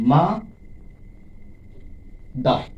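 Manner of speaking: "মা", a very long gap, then "দার"; teacher counting beats in মা ... দার; syllable by syllable. Shown as a demonstration. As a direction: break "mother" into two isolated syllables. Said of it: "দুইবার স্পর্শ করছে হাতে".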